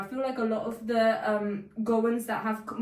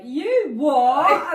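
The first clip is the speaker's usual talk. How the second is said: loud, higher-pitched mocking "what?"; mock incredulous shout; 5 semitones higher, 11 dB louder than usual. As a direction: shout it like a disbelieving classmate. Imitates classmates laughing at her word.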